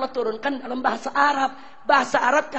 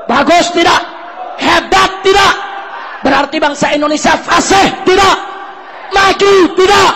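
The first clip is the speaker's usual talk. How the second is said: loud, high, demanding; rally the crowd; fiery shouted questions; 4 semitones higher, 8 dB louder than usual. Real, not acted. Preacher shouting questions to the congregation.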